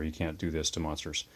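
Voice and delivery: normal talking voice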